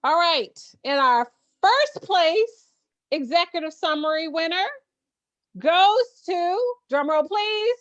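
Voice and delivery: high, bright announcer build-up; drawn-out pageant host reveal. Acted. Announces first place winner.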